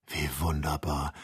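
low, soft marvelling; awed, softened voice; hushed wonder. A narrator's reading. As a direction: murmur it in quiet amazement. Joe marvels at the mirage.

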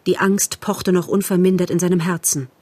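usual speaking voice

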